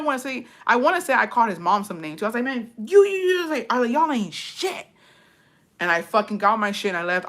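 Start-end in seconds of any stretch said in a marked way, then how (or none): 2.4-3.9 retold sputtering anger. Retells cursing everyone out. 3.9-4.9 high-pitched re-enacted contempt; retold scornful outburst. Woman cursing out the household, retold scornful outburst.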